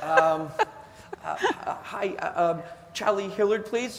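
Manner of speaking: high, halting and hesitant, stammering um and uh; a scared young pilot barely daring to ask; nervous caller. Retold or imitated. Nervous phone call to hero.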